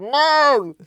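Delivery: put-on loud, high, muffled teen shout. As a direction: shout it as if through a wall. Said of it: "Like this muffled".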